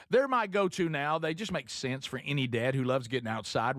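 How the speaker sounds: soft, easy host-read ad. Acted. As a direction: read the sponsor spot relaxed.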